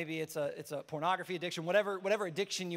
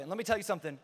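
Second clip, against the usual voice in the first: usual speaking voice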